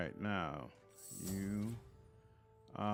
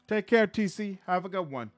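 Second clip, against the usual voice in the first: playful shout; 10 semitones higher, 11 dB louder than usual. Pretend. Streamer voicing game farewell.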